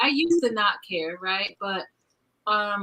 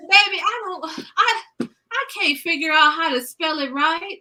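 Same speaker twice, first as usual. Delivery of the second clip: granny's voice; 6 semitones higher than usual. Retold or imitated. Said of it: "She goes".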